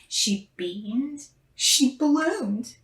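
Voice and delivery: ordinary delivery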